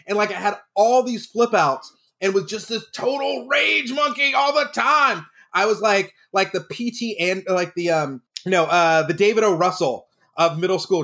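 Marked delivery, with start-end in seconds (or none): 2.4-5.2 high, drawn-out mock yell; hammed-up rage. Drama coach recalling his total flip outs, mock-raging.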